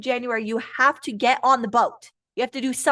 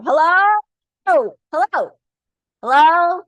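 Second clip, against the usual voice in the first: loud, higher, insistent hello; mock calling out; 5 semitones higher, 7 dB louder, slower than usual. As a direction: call out as if nobody is listening. Calling out after striking card reading.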